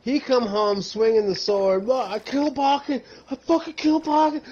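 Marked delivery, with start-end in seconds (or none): 1.8-4.4 raised voice, raging threat; angry yell. Retold or imitated. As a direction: yell it like a man losing his mind. Roommate quoting raging Casey.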